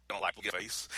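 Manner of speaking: usual speaking voice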